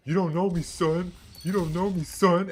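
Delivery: high, loud hype-up yell; defiant hype shout. Acted. Worker to exhausted crew at start of shift, defiant shout. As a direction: yell it like the pumped-up one at work. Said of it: "you're like".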